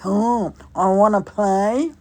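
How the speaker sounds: loud, stretched-out clown call; imitated clown shout. Clown repeating the call outside, loud drawn-out shout. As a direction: shout it slowly, rising at the end. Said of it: "And then he repeats".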